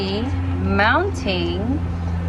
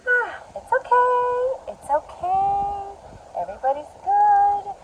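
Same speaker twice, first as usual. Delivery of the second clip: high, slow singsong all-clear; high-pitched reassurance; 11 semitones higher than usual. Real, not acted. Gardener after a gust of wind, high singsong reassurance. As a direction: stretch the last words out high, soothing after a scare.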